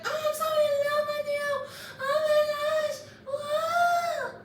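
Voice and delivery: very high, loud squealing swoon; mock lovesick gushing. Imitating the girl declaring love to Yuki, mock lovesick squeal. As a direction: wail it like a hammed-up lovestruck girl.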